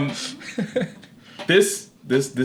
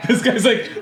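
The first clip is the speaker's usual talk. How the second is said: laughing; 10 semitones higher than usual. Real, not acted. Laughing at the clip.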